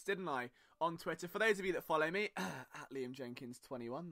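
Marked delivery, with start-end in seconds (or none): 2.4-4.1 slow, much softer handle drop, almost an aside; quick quiet plug. Real, not acted. Host tucks his own Twitter handle to listeners in a hushed aside. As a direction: slip in the Twitter handle under your breath. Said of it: "nice little plug there".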